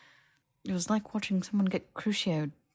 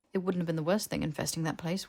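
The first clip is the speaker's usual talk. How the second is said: plain reading voice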